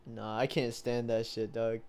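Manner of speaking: usual speaking voice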